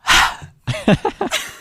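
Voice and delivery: sudden high giggle; high breathy laugh. Real, not acted. Laughing at sigh joke.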